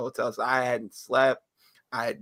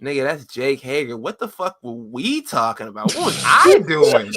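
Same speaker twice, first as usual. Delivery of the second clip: retold disbelief; 7 dB louder than usual. Retold disbelief at own hype.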